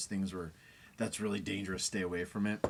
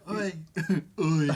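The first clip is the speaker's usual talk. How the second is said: [name]; 8 semitones higher, 9 dB louder than usual. Acted. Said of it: "Be all [name]".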